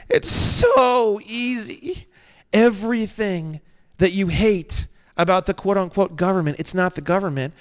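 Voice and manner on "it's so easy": slow, lilting "so easy" higher; drawn-out so easy. Shown as a demonstration. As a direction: stretch "It's so easy" slowly and higher. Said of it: "It's so easy repeated".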